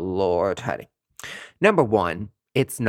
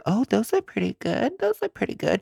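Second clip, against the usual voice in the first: mock gushing client; 10 semitones higher than usual. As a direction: coo it like a fooled client. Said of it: "they're gonna be like".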